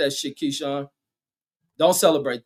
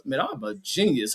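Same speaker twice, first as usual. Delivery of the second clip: ordinary talk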